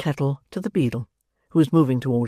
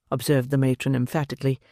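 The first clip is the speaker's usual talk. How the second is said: ordinary delivery, nothing marked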